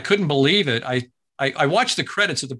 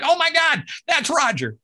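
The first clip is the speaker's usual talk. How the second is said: much higher, excited exclaim; surprised recognition; 13 semitones higher than usual. Retold or imitated. Fans hearing a familiar voice, surprised and higher. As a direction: gasp it like you just recognized him.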